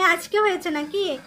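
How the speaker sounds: ordinary delivery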